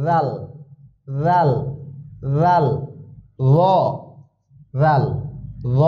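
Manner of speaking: ল and জাল said with the mouth a little round; rounded-mouth letter. Shown as a demonstration. Teacher shows students the rounded letter sound. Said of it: "মুখ একটু গোল হবে".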